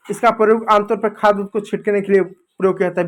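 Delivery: ordinary talk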